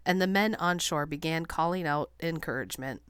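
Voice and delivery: plain reading voice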